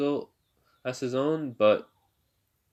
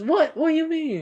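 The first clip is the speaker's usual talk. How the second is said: very high, louder mock shock; flustered outburst; 5 dB louder than usual. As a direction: squeal it as if caught out. Flustered girl reacts to teasing.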